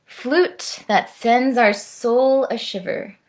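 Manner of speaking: lively, lilting read-aloud with swinging rhythm; sing-song picture book reading; reading to children. Acted. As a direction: perform the rhyme for little listeners, savouring each instrument. Reads picture book to kids.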